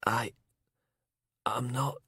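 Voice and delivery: thin, breathless whisper; weak whisper. Acted. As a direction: barely get the words out. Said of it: "sounding thin and weak".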